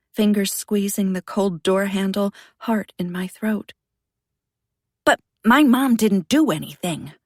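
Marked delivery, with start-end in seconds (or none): 5.0-7.1 loud, desperate denial; upset protest. Acted. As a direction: cry out in protest. Boy protests accusation about his mom.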